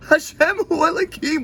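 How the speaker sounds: high, slow shouted prayer. Retold or imitated. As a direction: cry it out mid-ecstasy. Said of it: "you're like".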